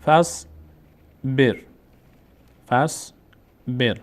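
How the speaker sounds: very slow two-syllable فصبر, each part held; silent connecting alef. Shown as a demonstration. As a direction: read فصبر as "fas... ber", alef dropped. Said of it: "خوانده نمیشه".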